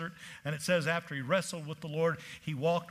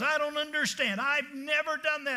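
higher, puzzled protest; indignant lady; 8 semitones higher than usual. Retold or imitated. Churchwoman objecting to the preacher, mock indignant. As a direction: protest your innocence, pitched up. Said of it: "And she goes".

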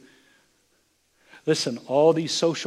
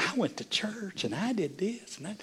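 higher, softer sing-song imitation; mock church talk; 7 semitones higher, 6 dB quieter than usual. Churchgoer telling a business meeting about church, mock sing-song. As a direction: imitate a churchgoer showing off, lightly.

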